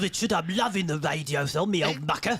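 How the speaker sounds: matey mock Cornish-Cockney voice; chummy Cornish Cockney, greeting an old mate; Ray. Co-host imitating a listener pledging money to the host, Cornish Cockney. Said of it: "That's how Ray speaks".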